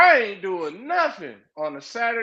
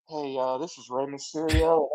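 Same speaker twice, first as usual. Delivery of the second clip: mumbled, low, soft impression of a shy commentator; a mild mock of a timid wrestler on the mic; imitating Rey Mysterio; 8 semitones lower, 4 dB quieter than usual. Mocking Rey's commentary to his co-host, low and mumbled. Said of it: "Rey always talks like this".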